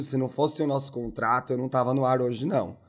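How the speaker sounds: tired, hungover low voice. Real, not acted. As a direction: drag the words out hungover. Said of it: "Estou numa ressaca".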